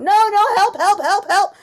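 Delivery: loud, high, frantic yelps of help; a trapped puppy howling for rescue; puppy crying out. Retold or imitated. Speaker voicing a trapped puppy, loud and high. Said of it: "like, crying out".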